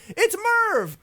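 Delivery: high, drawn-out mock scream; imitated scream. Little girl calling from a hotel balcony, imitated high scream. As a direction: squeal it out like a little fan spotting a star. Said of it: "screams, 'It's Merv!'".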